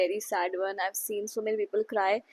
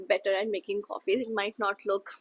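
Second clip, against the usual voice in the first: ordinary talk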